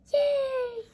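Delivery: high, squeaky little cheer; tiny creatures cheering. Acted. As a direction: squeal a happy yay. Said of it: "they cheered".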